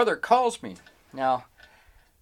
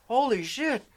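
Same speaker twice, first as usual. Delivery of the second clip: higher-pitched astonished reaction; awed amazement; 8 semitones higher, slower than usual. Retold or imitated. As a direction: imitate an impressed onlooker. Group's reaction retold.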